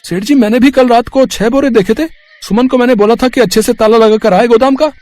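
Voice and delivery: high-pitched accusation. Acted. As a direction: shift the blame onto her, pitched well up. Ramesh accuses Suman before boss. (ऊँची आवाज़ में इल्ज़ाम लगाना)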